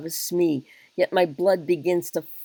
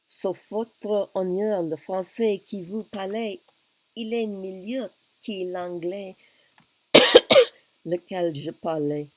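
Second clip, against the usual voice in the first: princess Katherine in French; 4 semitones higher, 4 dB louder than usual. Acted. A shy princess replying to the wooing king. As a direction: answer the king shyly in French.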